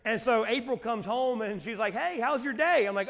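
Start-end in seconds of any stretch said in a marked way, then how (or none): 1.9-2.9 lightly voiced cheerful wife, pitched higher; higher, chirpy retold greeting; wife's cheery greeting. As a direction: imitate a breezy hello on coming home. Wife arriving home, cheerful greeting.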